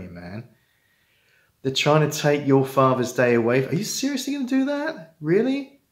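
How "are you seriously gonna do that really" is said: pitched way up in disbelief; incredulous. Real, not acted. Pleading with estranged dads, high incredulous. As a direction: challenge them, sky-high with disbelief.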